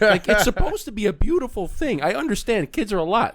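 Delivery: high, laughing protest. Real, not acted. Host laughing at parents who complain about kids. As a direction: laugh while making the point.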